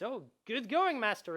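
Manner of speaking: a hint of a chirpy game sidekick; rabbit companion. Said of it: "and he says".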